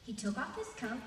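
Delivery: ordinary delivery, nothing marked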